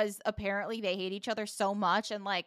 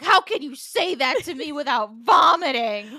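loud, horrified jokey outburst; appalled outburst; 3 semitones higher, 9 dB louder than usual. Retold or imitated. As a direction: yell it at her like she's gross. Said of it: "tosses her hand away and is like".

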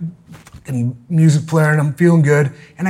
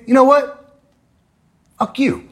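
higher, mock-tough insult; mock confrontation; 9 semitones higher than usual. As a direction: act out the comeback, pitched up. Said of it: "And I say that".

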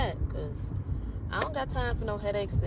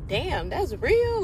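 impressed; 7 semitones higher, 4 dB louder, slower than usual. Retold or imitated. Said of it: "I was like".

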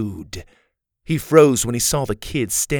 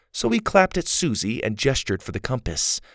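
ordinary delivery, nothing marked

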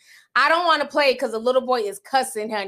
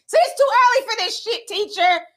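put-on higher, annoyed woken-up kid; a kid fresh from his nap scolding teacher; grumpy little boy; 8 semitones higher than usual. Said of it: "He wanted all the smoke".

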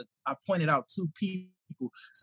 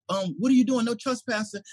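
raised, higher voice over the intercom; a celebrity warning off a stranger at his gate; Jamie Foxx on intercom; 6 semitones higher than usual. Retold or imitated. Jamie Foxx warning a trespasser over the intercom, higher pitched. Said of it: "He like".

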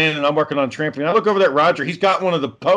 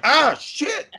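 startled curse; 11 semitones higher than usual. Retold or imitated. Dog bites him suddenly.

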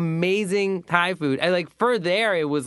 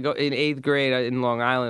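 ordinary talk

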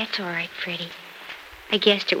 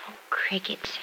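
whispered under her breath in dismay; hushed exasperated aside; muttered whisper; 9 dB quieter, slower than usual. Acted. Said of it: "unvoiced whisper".

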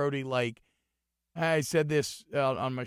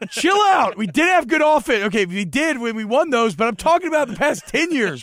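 high, loud, exasperated protest; shouted comeback; 12 semitones higher, 12 dB louder than usual. Real, not acted. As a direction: yell over the heckler to defend the point. Shouts back at co-host's objection.

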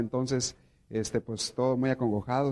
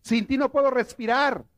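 high, louder melodramatic plea; one lover to the other, mocking the drama, raised high; mock lovesick lover; 10 semitones higher, 5 dB louder than usual. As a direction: ham up a soap opera declaration. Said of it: "le dice uno al otro".

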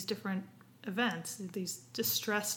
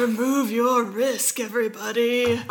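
slow, loud, slick salesman pitch; snake oil seller; 2 semitones higher, 8 dB louder than usual. Retold or imitated. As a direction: sell it like a huckster promising miracles. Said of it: "You sound like a snake oil salesman right now".

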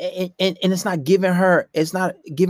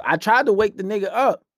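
usual speaking voice

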